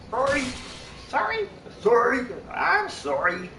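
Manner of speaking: string of high put-on apologies; jumping up into silly high voices; character voices. Shown as a demonstration. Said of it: "I can do characters".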